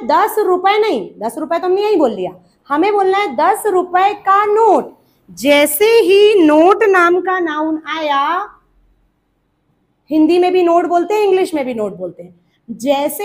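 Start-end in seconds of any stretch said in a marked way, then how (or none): 5.3-8.2 slow, loud stress on "नोट नाम का noun"; "नोट नाम का noun" said loudly and deliberately; emphatic rule. Shown as a demonstration. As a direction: hammer home "नोट नाम का noun", louder and drawn out. Teacher drives home the rule.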